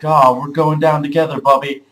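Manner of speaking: hammed-up defiant cry; dramatic vow. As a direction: declare it like a last stand. Dramatic vow in dream fight.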